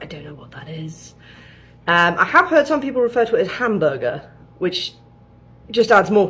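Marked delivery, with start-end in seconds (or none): 0.0-1.1 whispered blank bafflement; vacant stare whisper. Retold or imitated. Mimicking a butcher baffled by mince beef, whispered. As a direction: breathe it out like a lost cashier. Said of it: "you kind of get that vacant stare".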